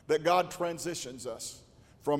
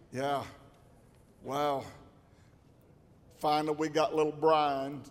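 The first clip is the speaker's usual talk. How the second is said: ordinary delivery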